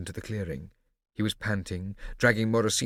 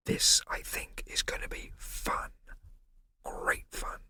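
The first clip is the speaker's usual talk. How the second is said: soft breathy whisper; whispered very quietly; 4 dB quieter, slower than usual. A narrator's reading. Scipio whispering to Prosper, very quietly. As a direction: barely whisper his name.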